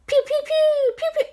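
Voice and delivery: very high, loud pew noises; laser zaps. Acted. Jack zapping ghosts, high-pitched laser pews. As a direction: fire a toy ray gun.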